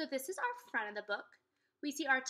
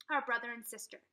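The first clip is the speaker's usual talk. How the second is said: usual speaking voice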